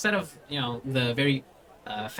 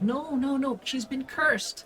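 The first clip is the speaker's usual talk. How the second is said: put-on very high little-kid voice; insistent small child; 11 semitones higher, slower than usual. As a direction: protest slowly and high, like an earnest child. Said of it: "the small child just goes like".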